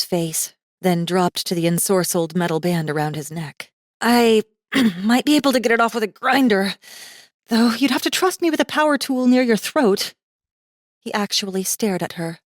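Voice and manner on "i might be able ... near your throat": put-on higher, quick, nervous young woman; hesitant girl. Girl offering to grind off his collar, quick and nervous. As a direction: offer it fast, half unsure.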